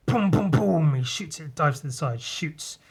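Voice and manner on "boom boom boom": mock gunfire boom. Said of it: "and he goes".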